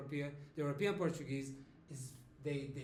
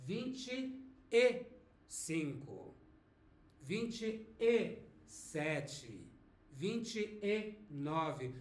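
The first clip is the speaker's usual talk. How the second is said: vinte e sete said very slowly with a full e; slow, full form; much slower than usual. Shown as a demonstration. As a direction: spell out every syllable slowly. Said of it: "when you are writing, actually you have to say".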